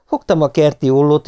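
plain reading voice

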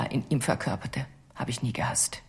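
a tender whispered admission; soft whisper. Acted. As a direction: let it fade into a whisper. Confession, emotional trailing.

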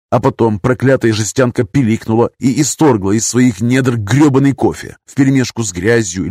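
usual speaking voice

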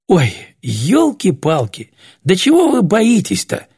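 high, exasperated outburst; indignant. A narrator's reading. Вася objects to everyone being scared, indignant. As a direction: protest with rising exasperation. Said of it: "возмутился Вася".

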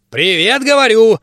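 squeaky gnome. A narrator's reading. Gnome mage calls out again.

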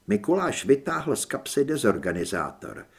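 plain reading voice

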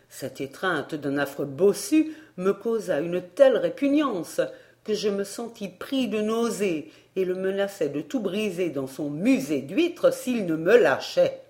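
usual speaking voice

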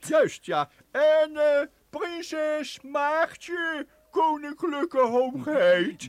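slow, drawn-out courtly address; pompous court marshal. Pretend. Professor as court marshal asking about the princess, slow and pompous.

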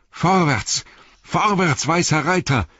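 high shout, like a racegoer; roared cheering. A narrator's reading. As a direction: cheer the rider on at a high pitch. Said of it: "brüllte er wie ein Zuschauer beim Pferderennen".

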